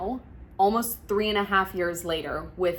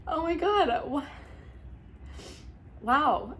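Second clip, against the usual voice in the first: overcome; 8 semitones higher than usual. Real, not acted. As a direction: caught off guard by emotion. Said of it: "getting emotional".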